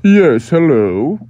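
slow, drawn-out formal butler. Acted. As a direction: answer the door as a stuffy butler. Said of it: "the butler said".

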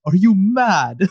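teasing UK rapper voice; mock UK taunt. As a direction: stretch it slow and mocking like a London rapper. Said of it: "Just like".

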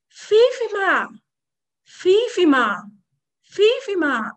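high, loud, drawn-out call; shouting a name. Acted. Asabi shouts after Fifima from afar, calling out. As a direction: call out after someone far off, pitch falling away each time. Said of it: "Asabi shouted".

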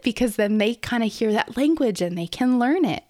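normal talking voice

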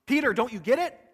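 John pressing Peter to understand; eager urging. Retold or imitated. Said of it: "Can't you hear him talking to Peter?".

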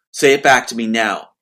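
loud, cold imitated order; cold command. Sister ordering her brother to repeat it, cold command. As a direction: punch out each word as a demand. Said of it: "In that kind of voice".

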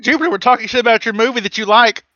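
ribbing a co-host by calling his name; teasing call-out. Real, not acted.

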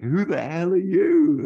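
high, loud, slowed-down yell; freaked-out shout. Retold or imitated. [name] shaken after the flash, shouting at [name]. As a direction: bellow it, staggering back in shock. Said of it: "Like that".

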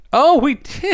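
loud, high burst of surprise; sudden realization. Real, not acted. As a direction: exclaim as the forgotten event hits you. Suddenly remembers forgotten concert.

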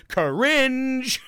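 loud, high mock cry of disgust; mocking listeners. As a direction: imitate listeners cringing out loud. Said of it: "everyone now is listening to me going".

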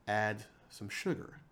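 ordinary delivery

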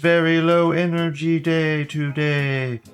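grand entrance announcement. Acted. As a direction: announce like a circus ringmaster. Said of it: "show intro announcement".

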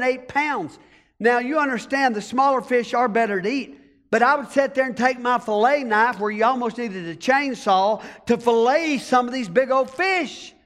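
ordinary talk, nothing marked

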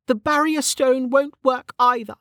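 high-pitched character voice, bitter explanation; Velika, bitterly explaining. Acted.

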